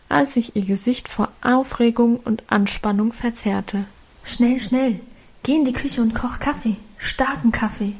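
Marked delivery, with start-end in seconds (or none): none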